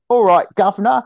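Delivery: high, loud, cartoonish British accent; fake English accent. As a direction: ham up a tourist's idea of a Londoner. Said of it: "these fake English accents".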